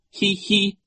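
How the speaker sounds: short, high hee-hee; giggle. A narrator's reading. Nero giggles at the coach, high tittering. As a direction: let out two high giggles. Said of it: "Nero stopped giggling".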